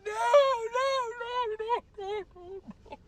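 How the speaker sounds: high wailing protest fading away; panicked pleading. Retold or imitated. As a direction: cry out, then let it die off. Retold panic, protest dying away.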